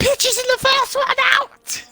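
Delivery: very high-pitched exclamation; astonished outcry. Real, not acted. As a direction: cry out the surprise result. Surprise as results come in.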